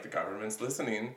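mock paranoid hush. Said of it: "it was also very, like".

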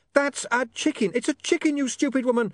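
very high, irate outburst; exasperated shouting. Acted. Breaking off to scold a customer, exasperated yell.

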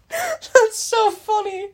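high, laughing through the words; slow, squeaky-high words through a laugh. Real, not acted. Podcaster cracking up at almost naming the school, laughing. Laughing at her own near slip.

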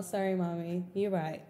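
ordinary talk

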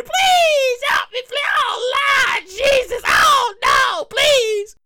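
very high, loud, slow shrieking pleas; screaming clam. Retold or imitated. Clam begging the chef for its life, high loud shrieks. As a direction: shriek for mercy like a clam being cracked. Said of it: "the clam's here".

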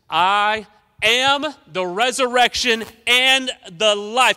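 loud, slow, declaring each word; proclaiming. Acted. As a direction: proclaim it as the cornerstone of faith. Proclaiming Jesus' key declaration.

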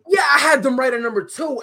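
loud high shout. Real, not acted. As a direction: call it out loud. Shouted reply.

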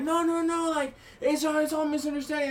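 high protesting voice; pleading family. Retold or imitated. Family insists it's a misunderstanding, in a high protesting voice. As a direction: wave it all away.

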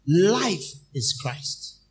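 slow, weighty emphasis on life; drawn out slowly. Acted. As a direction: stretch every word out. Preacher drawing out key phrase.

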